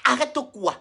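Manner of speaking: ordinary talk, nothing marked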